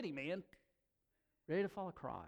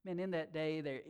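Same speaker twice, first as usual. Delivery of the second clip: normal talking voice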